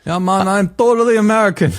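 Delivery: put-on tossing off a jokey Jamaican accent. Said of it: "That was a very good accent".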